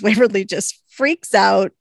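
usual speaking voice

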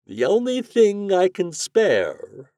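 very high, slow wizard voice; drawn-out musing in a theatrical voice; thoughtful wizard. A narrator's reading. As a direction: ponder aloud, stretching the words high. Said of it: "replied the wizard thoughtfully".

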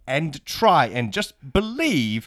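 slow, drawn-out encouragement; mock pep talk. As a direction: urge him on slowly, stretching the words.

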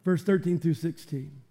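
usual speaking voice

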